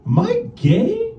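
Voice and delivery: drawn-out, high rising question; worried self-questioning. Acted. Acted morning-after doubt.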